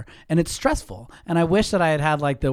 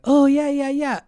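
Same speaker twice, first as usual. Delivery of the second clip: high, louder gushing yes; fake enthusiasm; 13 semitones higher, 7 dB louder than usual. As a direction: overdo the eager agreement to dodge her. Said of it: "than me being like".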